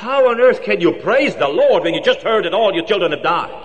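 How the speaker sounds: shocked protest. Acted. Secretary protests his praise.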